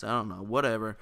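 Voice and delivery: normal talking voice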